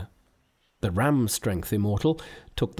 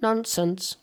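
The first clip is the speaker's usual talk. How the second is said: very high, dismissive Monkey voice; Monkey waves off Sandy's worry, very high; Monkey whispering; 11 semitones higher than usual. Acted. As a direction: brush it off like a cheeky monkey. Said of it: "whispered Monkey".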